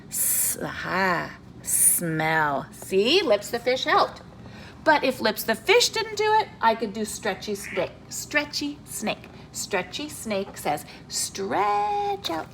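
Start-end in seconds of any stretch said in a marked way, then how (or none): 0.1-2.7 sounding it out. Shown as a demonstration. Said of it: "I'm gonna say". 11.1-12.5 stretched, slow and high cartoon snake. Teacher voices stretchy snake.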